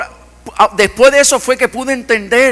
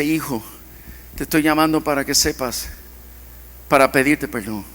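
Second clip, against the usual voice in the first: softer, broken father asking forgiveness; tender father; 6 semitones lower, 7 dB quieter than usual. Retold or imitated. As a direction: say it gently, like a humbled father. Father apologizing on phone.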